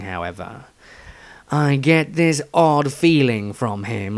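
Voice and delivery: Dieter diary voice. Acted. S0 reads Dieter's diary entry.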